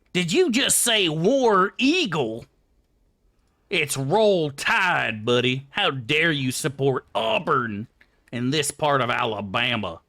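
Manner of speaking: slow, drawn-out fan voice, lightly voiced; angry Alabama football fan. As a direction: play an offended fan defending his team. Acting offended Alabama fan.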